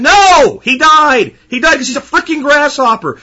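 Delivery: high, loud, exasperated shout; angry outburst. Real, not acted. Host shouting down the happy ending, real angry outburst. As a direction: blow up at the lie. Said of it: "I get so pissed off".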